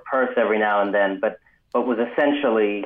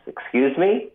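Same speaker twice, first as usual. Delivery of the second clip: put-on "Excuse me?" pitched higher, a puzzled question in a Bangladeshi accent; 9 semitones higher, slower than usual. Clerk answers the gunman's question, Bangladeshi accent. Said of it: "that accent in the word".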